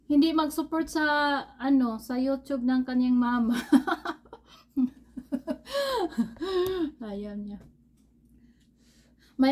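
chewing while talking; words muffled by food; mouth full of food. Real, not acted. Seen 0: Speaker holds food near mouth, lips pursed as if chewing, then bites.

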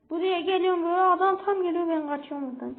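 ordinary talk

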